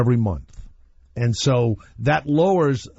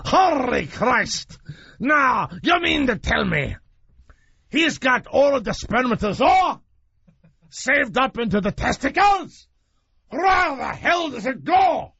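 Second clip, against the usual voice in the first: imitating the phone guy; 11 semitones higher than usual. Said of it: "Like that. I swear to God, that's how he spoke".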